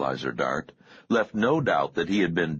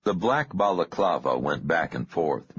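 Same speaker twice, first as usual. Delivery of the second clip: plain reading voice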